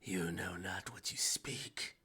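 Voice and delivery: slow, whispery menace; hushed, drawn-out warning in character; menacing foe. Acted. Game master voices menacing foe.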